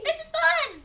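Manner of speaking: high, loud, gleeful monkey squeal; naughty baby monkey. Acted. Baby monkey up in the tree, high glee.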